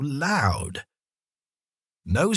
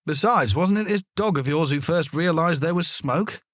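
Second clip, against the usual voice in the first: policeman; 6 semitones higher than usual. A narrator's reading. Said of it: "the policeman told Mum".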